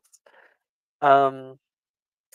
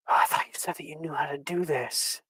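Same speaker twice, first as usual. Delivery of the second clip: tense whisper; 10 dB quieter than usual. Acted. Nolan's tense whispered retort.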